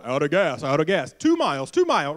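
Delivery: low, loud mock car alert chanting out of gas; low droning car warning voice. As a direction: imitate a dashboard nagging the driver. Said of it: "Some of your cars go".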